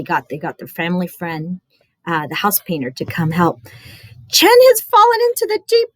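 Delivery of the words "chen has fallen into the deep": put-on high, loud frantic boy; crying out. Boy begging the house painter for help, high panicked cry. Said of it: "he cried".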